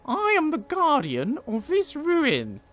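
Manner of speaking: high, slow, loud guardian of the ruin; mummy guardian voice. Acted. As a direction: announce yourself as the ancient guardian. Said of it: "I'll do a better voice".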